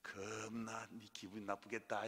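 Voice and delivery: slow, low, soft Jeolla sympathy; Jeolla dialect. Acted. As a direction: drawl it softly in put-on Jeolla dialect. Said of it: "요즘엔 전라도 말로 많이 해주시는 것". (느릿한 전라도 사투리 흉내)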